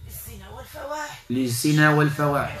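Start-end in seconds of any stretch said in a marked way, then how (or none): 0.1-1.2 distant, hushed possessed voice; thick strong voice. Real, not acted. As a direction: murmur it like a spirit speaking through her. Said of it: "بصوت غليظ قوي".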